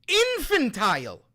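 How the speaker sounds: INFANTILE thrown out high and loud; loud, indignant "Infantile"; exasperated exclamation. Real, not acted. Repeats the word in outrage.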